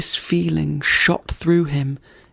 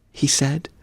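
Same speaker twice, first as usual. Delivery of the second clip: ordinary delivery, nothing marked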